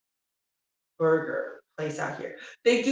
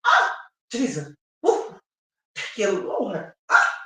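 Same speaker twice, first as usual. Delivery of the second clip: retold grateful outburst, punctuated with gasps; grateful praise; 5 dB louder than usual. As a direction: whoop your thanks to the Lord. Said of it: "so grateful".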